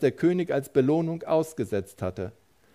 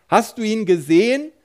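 higher, louder alarmed call; frightened calling; 5 semitones higher, 4 dB louder than usual. A narrator's reading. Frightened soldiers calling to each other, loud alarmed cry. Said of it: "riefen sie einander zu".

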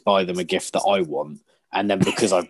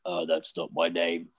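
gruff dad; 5 semitones lower, 7 dB quieter than usual. Retold or imitated. The dad's reply retold.

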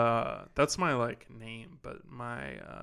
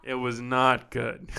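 ordinary talk, nothing marked

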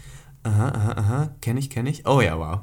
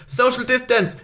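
high, loud warning yell; shouting down; 12 semitones higher, 6 dB louder, slower than usual. Retold or imitated. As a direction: yell it from a balcony at people below. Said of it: "dann schreie ich immer runter". (lautes Herunterrufen)

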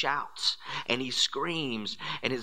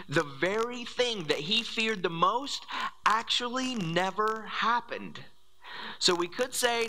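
preaching at full force; 4 semitones higher, faster than usual. Real, not acted. Fired-up preaching climax.

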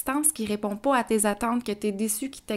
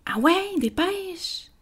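higher, exasperated rushing; impatient hurry-up; 9 semitones higher, much slower than usual. Retold or imitated. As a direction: rush the child impatiently. Impatient parent rushing child.

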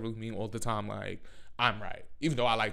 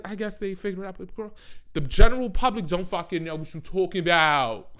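ordinary delivery

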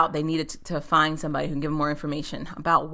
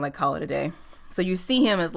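normal talking voice

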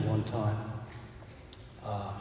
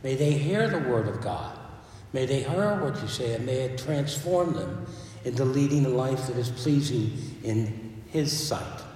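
ordinary delivery

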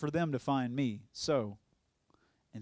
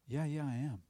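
whispered reply. Retold or imitated.

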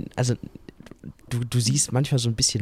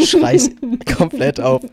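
mock scream; 14 semitones higher, 8 dB louder, much slower than usual. Joking about screaming in surgery.

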